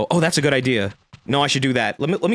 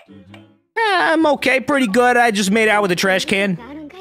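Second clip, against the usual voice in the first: character voice; 8 semitones higher than usual. Pretend. Voicing the game kid answering his neighbor. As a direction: squeak out the kid's answer, pleased with himself.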